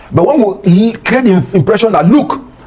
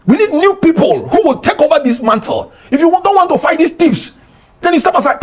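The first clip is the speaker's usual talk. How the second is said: raised, much higher, rallying the crowd; impassioned shouting; 9 semitones higher than usual. Real, not acted. Railing at party leaders, urged to stop screaming, high impassioned yell. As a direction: climb high and hammer each demand. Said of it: "you don't need to scream".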